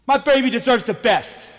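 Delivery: normal talking voice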